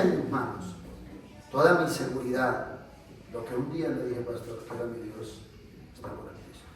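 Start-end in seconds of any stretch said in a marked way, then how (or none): 4.2-6.7 broken plea. Retold or imitated. Ruined man pleads to pastor.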